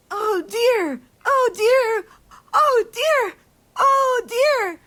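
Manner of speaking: high, loud toddler calls, imitated; a mother voicing her child's excited cries; little child crying out. Mother mimics child pointing.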